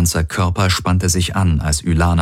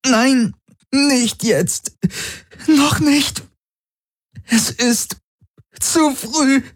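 much higher, strained woman's voice; pained woman pleading; much slower than usual. A narrator's reading. Ylana begging the baby not to come yet. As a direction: plead through the contractions.

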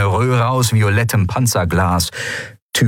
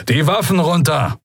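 higher-pitched military order; commanding; 9 semitones higher than usual. A narrator's reading. Young commander to trapped pirates, first firm command. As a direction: order them firmly to disarm. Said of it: "befahl ein Weißmantel".

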